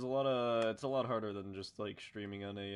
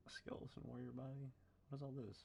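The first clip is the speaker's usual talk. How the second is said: whispering; 4 semitones higher, 10 dB quieter than usual. Real, not acted. Whispered while browsing.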